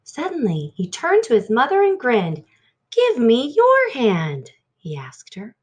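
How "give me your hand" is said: higher, louder, slow eager request; excited little boy; eager child. Acted. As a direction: grin through it. Child grinning with an idea.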